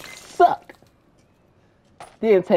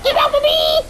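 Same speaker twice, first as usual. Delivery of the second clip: much higher, loud shriek of protest; panicked yell; 5 dB louder than usual. Real, not acted. Gamer yelling at a game enemy grabbing him, panicked shriek. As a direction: yell to shake them off.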